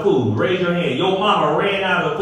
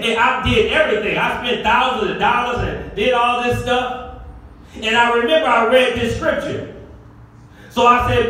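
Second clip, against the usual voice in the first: ordinary talk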